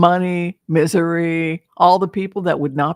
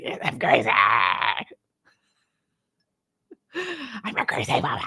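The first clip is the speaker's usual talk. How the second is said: creepy crazy woman. Pretend. Crazy woman invites the stalker.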